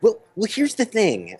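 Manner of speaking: high-pitched impression of an absent friend; Bob. Co-host does his best imitation of their missing friend, high put-on voice. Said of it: "gonna do my best Bob imitation".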